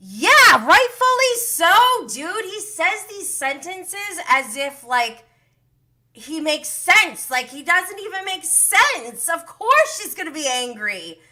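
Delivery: high, loud indignant outburst; exasperated rant. Real, not acted. Vlogger ranting about Tom's excuse, high and exasperated. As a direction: vent at him like he's impossible.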